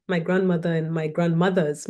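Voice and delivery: normal talking voice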